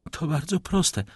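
a light character voice for a woman; lightly voiced lady explaining. A narrator's reading.

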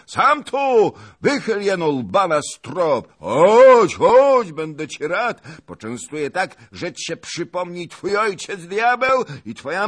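very high, loud bellowing insults; roaring taunts. Acted. Zagłoba roaring insults down at Bohun. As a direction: roar like a bull. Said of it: "ryczał".

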